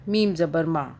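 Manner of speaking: ordinary talk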